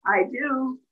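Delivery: ordinary delivery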